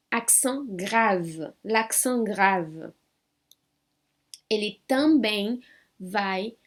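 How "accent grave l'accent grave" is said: accent grave said very slowly and clearly; slow demo. Shown as a demonstration. Teacher naming the accent for students, very slowly. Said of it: "Um ecrasiado".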